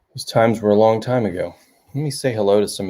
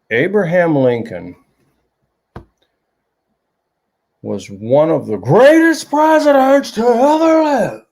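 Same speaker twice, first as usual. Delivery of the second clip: imitating the teacher, voice rising much higher and louder; raised voice; 8 semitones higher, 5 dB louder than usual. Teacher lecturing the class, voice building high and loud. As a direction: swell up like a lecturer riding a wave. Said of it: "he would raise and lower his voice".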